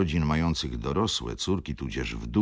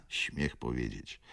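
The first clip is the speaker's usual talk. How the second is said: ordinary delivery, nothing marked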